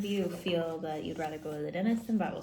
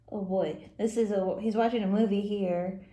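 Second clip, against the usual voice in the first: ordinary delivery, nothing marked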